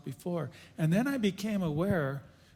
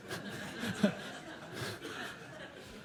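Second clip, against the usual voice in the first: quiet airless chuckle; soft breathy laugh; 3 semitones higher, 10 dB quieter than usual. Real, not acted. Amused laugh recalling his mother's harsh comfort. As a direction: laugh it off under your breath. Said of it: "a little compassion here".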